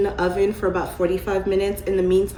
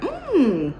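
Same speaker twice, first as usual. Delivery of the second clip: greedy food hum; 8 semitones higher than usual. Retold or imitated. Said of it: "you be like".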